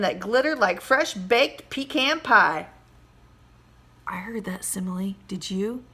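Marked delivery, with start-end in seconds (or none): 4.1-5.8 softer, unvoiced whisper aside; stage whisper. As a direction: whisper a secret to the class. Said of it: "teacher aside whisper".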